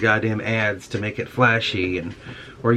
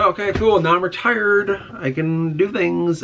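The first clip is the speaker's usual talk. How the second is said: higher, bright mock-cheerful new retiree, slowing at the end; mock cheery retiree; 7 semitones higher than usual. New retiree mocked as clueless, higher mock cheer. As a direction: play a retiree who suddenly has nothing to do, higher and cheery. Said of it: "you're like".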